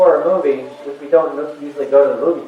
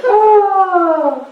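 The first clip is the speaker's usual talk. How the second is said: high, showy barker patter; carnival barker voice; 9 semitones higher, slower than usual. Retold or imitated. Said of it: "he just sounds like".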